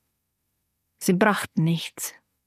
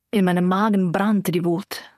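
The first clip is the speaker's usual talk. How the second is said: usual speaking voice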